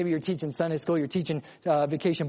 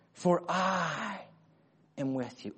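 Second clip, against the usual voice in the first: "I" held out and set apart, the rest dropping softer; stress on I; 4 dB quieter, slower than usual. Shown as a demonstration. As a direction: lean hard on "I", then ease off.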